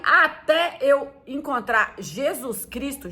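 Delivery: ordinary delivery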